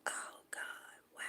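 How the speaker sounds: barely voiced, whispered aside; awed whisper. Sitting there hearing friend's boasts, stunned whisper. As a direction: whisper it under your breath, acting the reaction. Her reaction to friend's bragging.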